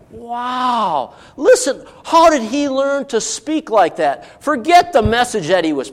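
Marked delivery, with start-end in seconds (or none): 0.1-4.4 higher, slow, impressed by the delivery; mild mock wonder at his speech; mock amazed townsfolk. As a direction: lightly voice gawking listeners. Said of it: "they go".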